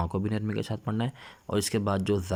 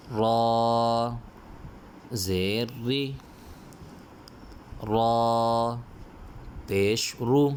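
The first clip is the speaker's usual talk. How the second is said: Qaida recitation drill; 3 semitones higher, much slower than usual. Shown as a demonstration. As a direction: call out the letters so the class can repeat. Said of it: "سبق میں جب پڑھتا ہوں تو آپ میرے ساتھ ساتھ سبق کو دہرایا کریں".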